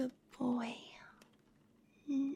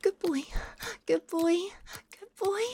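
excited; 6 semitones higher than usual. Acted. Climax, repeated praise.

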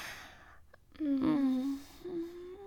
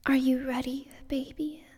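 honey sweet; 4 dB louder, faster than usual. Acted. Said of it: "My honey sweet voice".

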